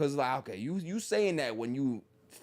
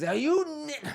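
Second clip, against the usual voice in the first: higher-pitched acted outburst; mock upset outburst; 12 semitones higher than usual. Upset partner lashing out in an argument, mock outburst. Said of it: "when they get upset".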